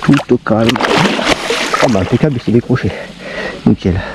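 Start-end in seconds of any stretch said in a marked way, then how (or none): none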